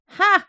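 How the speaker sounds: loud, high exclamation of delight; king's triumphant ha. A narrator's reading. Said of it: "rief der König aus".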